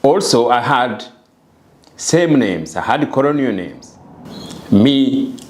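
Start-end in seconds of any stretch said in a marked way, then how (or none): none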